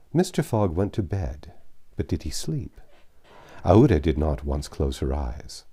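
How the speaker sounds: usual speaking voice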